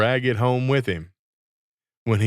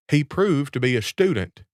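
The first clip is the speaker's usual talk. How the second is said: plain reading voice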